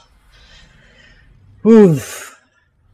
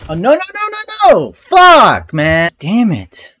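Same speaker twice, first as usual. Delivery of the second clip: high frustrated shouting; raging gamer; 5 semitones higher, 4 dB louder than usual. Real, not acted. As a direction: yell out in rage. Gamer dies to boss.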